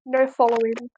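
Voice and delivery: ordinary delivery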